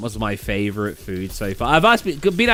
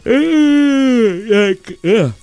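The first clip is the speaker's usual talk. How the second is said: disgusted ugh; 10 semitones higher, 6 dB louder than usual. Real, not acted. Disgusted reaction.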